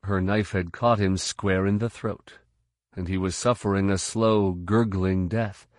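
ordinary delivery

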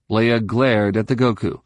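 plain reading voice

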